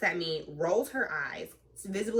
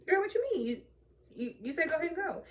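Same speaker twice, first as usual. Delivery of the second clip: high-pitched, stammering disbelief; incredulous protest; 12 semitones higher, faster than usual. Retold or imitated. Driver answering the partner who blames them, incredulous protest.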